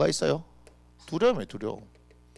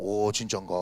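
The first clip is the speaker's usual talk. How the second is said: doubtful; 7 semitones lower, 5 dB quieter than usual. Retold or imitated. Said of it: "이렇게 얘기하고".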